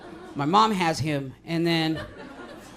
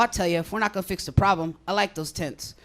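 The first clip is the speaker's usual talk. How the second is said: ordinary talk, nothing marked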